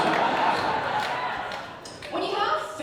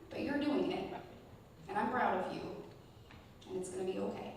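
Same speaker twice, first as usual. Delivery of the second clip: tender pep talk; 6 dB quieter than usual. Retold or imitated. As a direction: talk a scared little boy down gently. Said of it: "And I'm like".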